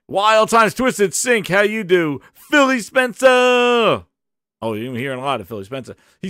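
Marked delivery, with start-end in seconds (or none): 2.3-4.0 stretched shout. Acted. Drawn-out shouted name.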